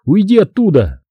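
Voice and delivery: high urgent shout; yelling warning. A narrator's reading. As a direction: warn him off, yelling. Narrator yelling warning to friend. (кричит другу уйти от грузовика)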